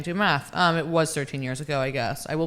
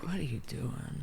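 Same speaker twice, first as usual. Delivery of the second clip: much softer, slow self-rebuke; self-scolding mutter; 3 semitones lower, 12 dB quieter than usual. Retold or imitated. Comic quietly scolding herself mid-set. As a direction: hush it to yourself in disbelief. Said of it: "And I'm like".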